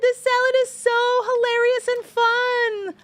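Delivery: high, bubbly mock ad giggle voice; hammed-up delighted salad commercial voice; mock giddy salad ad woman. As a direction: gush over the salad like a laughing ad model. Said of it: "they're like".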